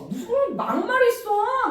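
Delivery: high, slow mock indignation with a laugh; retorting to the fiancé, high and drawn out; indignant retort. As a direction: drag out a high, laughing protest. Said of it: "막 그랬어".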